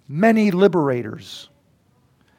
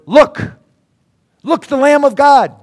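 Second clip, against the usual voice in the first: loud exclamation; 8 semitones higher, 8 dB louder than usual. Shown as a demonstration. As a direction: call it out loud and high. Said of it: "There is no soft talking expression".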